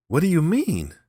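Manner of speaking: high, loud startled cry; cried out. Acted. Kiave cries out at the old man, high and loud. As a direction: shout it in alarm. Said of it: "cried Kiave".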